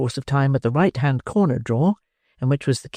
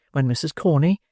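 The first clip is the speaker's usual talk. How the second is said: plain reading voice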